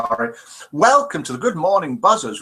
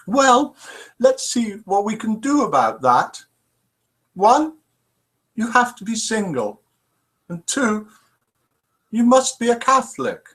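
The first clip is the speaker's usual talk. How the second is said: put-on mildly higher, gentle old nun; old nun's voice; 6 semitones higher than usual. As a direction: lightly voice the kindly old nun.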